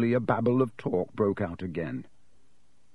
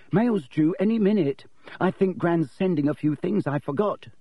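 higher, bright and friendly greeting; cheerful; 7 semitones higher than usual. A narrator's reading. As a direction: chirp it out like a happy boy at breakfast. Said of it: "greeted them cheerfully".

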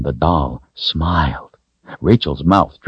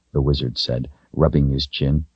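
plain reading voice

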